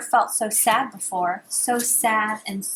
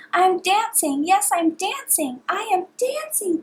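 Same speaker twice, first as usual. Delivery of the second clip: rising higher and higher with delight; joyful cry; 8 semitones higher than usual. A narrator's reading. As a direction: burst out with joy at dancing. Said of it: "Gerald cried".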